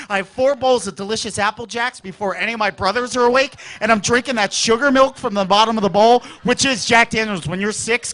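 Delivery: ordinary talk, nothing marked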